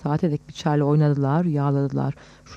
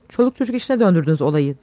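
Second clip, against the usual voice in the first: loud narrated outburst; angry shout; 6 dB louder, faster than usual. A narrator's reading. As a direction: raise your voice in reproach. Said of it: "diye bağırdı". (öfkeyle bağırma)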